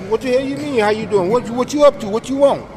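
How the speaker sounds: put-on New York defensiveness; suspicious New Yorker. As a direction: act out a wary stranger firing back questions. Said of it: "something like".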